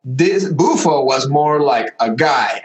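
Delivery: normal talking voice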